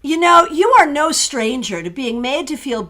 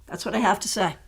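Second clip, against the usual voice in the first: ordinary talk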